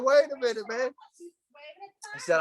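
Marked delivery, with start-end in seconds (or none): 0.0-0.9 high-pitched imitated protest; retold cry to hold on; mock calling out. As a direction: call after them, much higher. Retold calling after passing truck.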